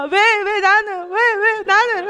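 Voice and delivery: high, slow whiny sob; imitating a crying child. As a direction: wail it like a crying kid. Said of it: "우주가 울면서". (울먹이는 아이 흉내)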